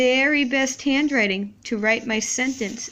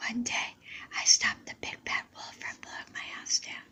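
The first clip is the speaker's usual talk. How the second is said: soft unvoiced whisper to yourself; whispered reread; 9 dB quieter than usual. Shown as a demonstration. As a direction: whisper it back to check it makes sense. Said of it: "I'm gonna whisper mine back to myself".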